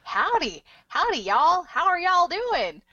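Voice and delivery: hammed-up Southern greeting in a Texas accent; exaggerated Texas accent. Imitating British people doing Americans, exaggerated Texas accent. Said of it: "like, the Texas accent".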